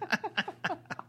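high burst of laughter; laughing. Real, not acted. Laughing at the joke.

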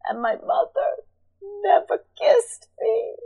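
high, tearful retold sob of a boy; imitating crying. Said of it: "And then he starts crying".

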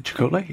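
Chakotay? asked with a rising, doubtful tune; unsure try at the name. Shown as a demonstration. Host unsure how to say the name, rising question. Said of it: "It's hard to say his name".

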